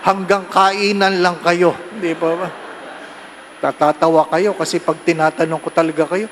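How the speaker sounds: ordinary talk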